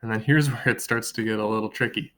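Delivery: usual speaking voice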